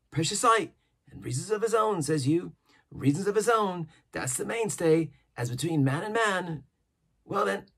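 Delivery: an odd, jittery hermit rattling on; eager old castaway. A narrator's reading. Ben Gunn voiced in reading.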